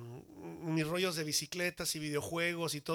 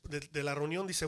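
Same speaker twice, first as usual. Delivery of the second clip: usual speaking voice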